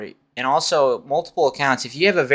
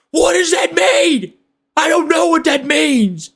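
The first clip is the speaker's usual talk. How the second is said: shrieked high and slow; high slow shout. Shown as a demonstration. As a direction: shriek it in confusion.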